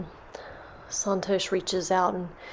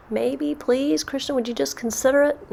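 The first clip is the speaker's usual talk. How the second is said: higher, coaxing entreaty; pleading; 6 semitones higher than usual. Acted. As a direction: beg to be given a chance. Santosh begs Krishna once more.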